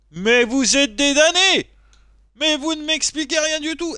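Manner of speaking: high, indignant cry, stretched out; mock outrage. Player railing at the game's confusing panel, mock outrage. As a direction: protest loudly and high at being left in the dark.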